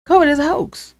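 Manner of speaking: mock the deniers with a high scoff; high sarcastic mimicry of the hoax line; mocking sarcasm. Mocking deniers' claim again.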